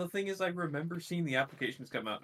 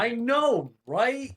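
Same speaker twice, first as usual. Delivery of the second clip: high, loud indignant agreement; hammed-up indignation; mock outrage; 11 semitones higher, 8 dB louder, much slower than usual. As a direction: cry it out high, in playful outrage. Mock outrage echoing another speaker.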